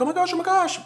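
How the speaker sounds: high-pitched pretend freak-out; hammed-up little panic squeal; mock stressed panic. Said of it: "not that I'm sitting here like".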